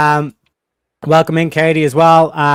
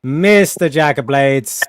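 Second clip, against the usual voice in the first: announcer intro; 3 semitones lower, slower than usual. Pretend. Introducing guest like host.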